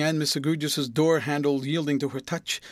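ordinary delivery, nothing marked

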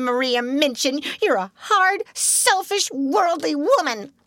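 high, frantic throughout; hysterical wild tirade. Acted. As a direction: rail at the hard sister, half sobbing. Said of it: "she cried wildly".

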